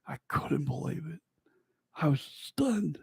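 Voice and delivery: hushed, breathy disbelief; stunned whisper. Retold or imitated. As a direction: whisper it as if too amazed to speak. Stunned at finding the ring.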